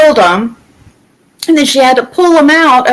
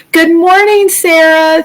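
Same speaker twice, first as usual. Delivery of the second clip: bright greeting; 6 semitones higher than usual. Real, not acted. Greeting viewers in live chat.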